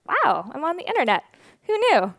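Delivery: amused surprise. Retold or imitated. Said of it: "and I was like".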